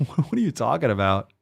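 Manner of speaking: laughing through the question; laughing disbelief. Real, not acted. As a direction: laugh at the absurdity as you ask. Reacting again, laughing.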